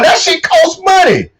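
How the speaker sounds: drawn-out shout, preacher style; emphatic shout. Real, not acted. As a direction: hammer home the point about cost. Ranting about daily costs.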